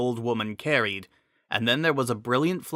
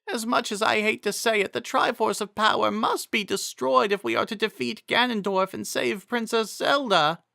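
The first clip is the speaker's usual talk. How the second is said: higher voice, anxious and concerned; an old protector fussing over her lady; worried Impa; 10 semitones higher than usual. Acted. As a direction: Impa asking the fallen Miranda if she's hurt, worried.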